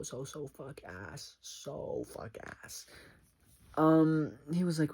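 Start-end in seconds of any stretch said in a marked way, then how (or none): 0.0-2.9 slow hushed emphasis. Real, not acted. Savoring her own crude phrase, in a slow hushed voice. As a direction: drop to a slow half-whisper, savoring each word.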